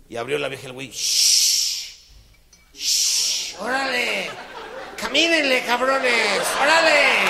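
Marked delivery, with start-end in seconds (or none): 0.9-3.6 loud hissed shush; drunken loud shushing. Retold or imitated. Drunk hushes snails. 3.6-7.2 put-on very high, very loud drunk herding snails; loud high drunken yelling; drunken shout.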